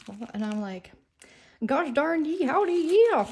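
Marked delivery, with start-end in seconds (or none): 1.6-3.2 loud, high, hammed-up hillbilly voice; mock hillbilly accent. Replying to Kelly's posh voice message, a loud, high mock hillbilly accent. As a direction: play the country bumpkin answering the posh lady, loud and high. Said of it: "like a hillbilly".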